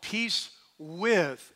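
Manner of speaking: WITH drawn out after a slow "peace"; slow, deliberate emphasis on "with"; stress on WITH. Shown as a demonstration. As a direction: lean on the word "with" and hold it. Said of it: "emphasize the word with".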